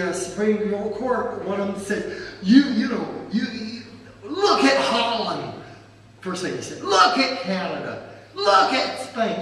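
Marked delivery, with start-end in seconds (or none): 2.4-5.5 loud, raised, emphatic courtroom jab; mocking lawyer's outburst; mock attack. Acted courtroom attack by opposing lawyer. 6.8-9.4 loud, drawn-out courtroom jab; exaggerated opponent, laughter creeping in; mock attack. Acted courtroom attack by opposing lawyer.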